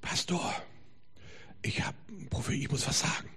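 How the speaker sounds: hushed, halting whisper, acting out a nervous child; timid, breath-only mock stammer; trembling nervous girl. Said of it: "ganz zitterig und wackelt".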